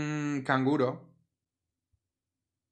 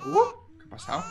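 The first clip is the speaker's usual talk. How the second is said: much higher surprised yelp; startled. Real, not acted. Player startled by a crying baby, much higher. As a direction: jump at the sudden cry.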